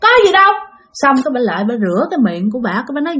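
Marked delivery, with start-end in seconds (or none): 0.0-0.5 higher-pitched startled denial; wife caught off guard. Acted. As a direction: act out a quick brush-off when caught. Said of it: "giống như lúc đó là giật mình".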